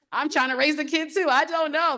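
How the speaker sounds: high-pitched, playful helpless protest; retold reply in a high, comic plea; mock exasperation. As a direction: throw it up high like you're clueless too. Retold panicked reply to friend's question.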